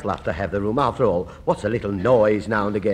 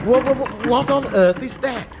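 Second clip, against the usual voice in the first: sudden high alarmed cry; startled; 11 semitones higher, 3 dB louder than usual. Acted. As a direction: jump at the strange noise. Strange noise from the pipes.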